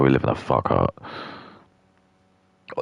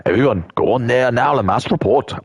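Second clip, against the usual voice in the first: mock Indian police officer; 7 semitones higher than usual. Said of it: "they just go on there and they go".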